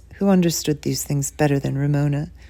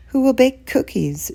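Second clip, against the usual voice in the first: put-on plaintive little girl; 7 semitones higher than usual.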